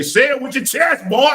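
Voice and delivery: high, loud, belted catchphrase; loud mock hype shout; say it with your chest. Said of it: "what they say".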